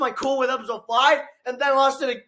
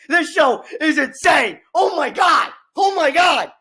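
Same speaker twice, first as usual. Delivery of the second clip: drawn-out, raised cries of amazement; awestruck outburst; 4 dB louder than usual. Real, not acted. Fan raving about a superhero show, awestruck outburst. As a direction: rave with full-voiced disbelief.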